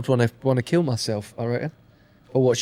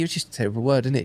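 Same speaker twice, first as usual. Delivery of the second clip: ordinary talk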